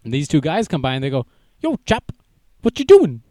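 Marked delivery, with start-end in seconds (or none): 1.6-3.2 high, loud mock Swedish "chap" voice; hammed-up Swedish accent, much higher. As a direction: put on a silly Swedish accent. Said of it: "That's how the Swedish talk".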